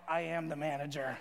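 low, mock-authoritative retort; lightly voiced woman in charge, low; stern lunch lady. Imagined lunch lady's stern retort.

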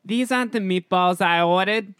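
loud, high put-on voice with a New York accent; high-pitched New York. Actor following director's note for really high pitch, high New York voice. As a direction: push it up high and project. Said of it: "high pitch, really high pitch".

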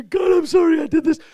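loud, high mock grovel; groveling prayer. A worshipper groveling before God, mocked loud and high. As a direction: ham up a self-pitying apology to God. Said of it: "You don't come and say".

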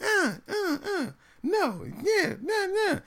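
put-on high kids bickering back and forth; imitating two kids going uh uh, nah; kids arguing. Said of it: "going back and forth".

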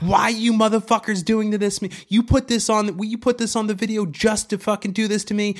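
high-pitched hammed-up screaming; mock scream. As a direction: wail it high like she's losing her mind. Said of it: "screaming like".

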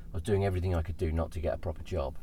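usual speaking voice

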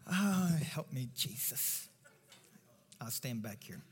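ordinary talk, nothing marked

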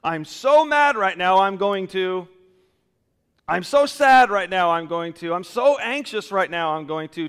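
quoting someone who is carried away by emotion; mock emotional outbursts. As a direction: ham up the mad, sad, anxious voices. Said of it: "Are my choices coming from a thankful heart?".

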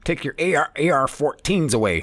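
high, heated mock rant; hammed-up furious old politician; imitating angry Biden. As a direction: jab the words like a finger in his face. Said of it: "Biden told the man, pointing his finger".

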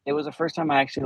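ordinary delivery

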